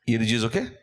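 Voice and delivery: usual speaking voice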